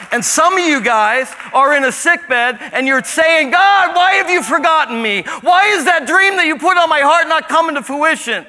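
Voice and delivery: high, loud sermon delivery building then easing; fiery preaching. Acted. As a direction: preach it to the back row, then bring it down. Preaching at full force.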